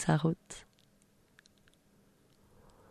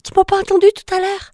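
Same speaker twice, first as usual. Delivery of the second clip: much higher, pleading, boastful; frog princess voice; 7 semitones higher than usual. Acted. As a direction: sell yourself to him, squeaky and eager. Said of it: "la grenouille lui dit".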